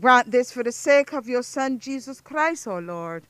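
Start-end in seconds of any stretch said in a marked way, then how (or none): none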